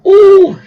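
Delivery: very high, loud "oh"; retold amazed whoop. Mate admires the police bike, loud high whoop.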